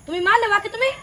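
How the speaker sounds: high, loud accusing question; angry confrontation. Acted. Mother-in-law confronting wife over hitting the child, angry.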